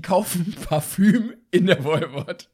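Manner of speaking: laughing through the words; breathless, giggling disbelief. Real, not acted. Shocked at cheap perfume.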